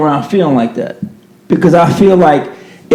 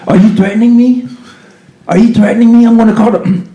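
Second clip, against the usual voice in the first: higher, put-on alarmed accuser voice; mock accuser; 6 semitones higher than usual. Imitating someone playing victim, mock alarmed whine. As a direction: mimic a fake-frightened accuser.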